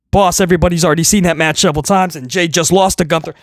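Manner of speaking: low, hurried employee; timid underling. Retold or imitated. Writer warning boss about repeated match, low and hurried. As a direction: protest quickly in a low voice.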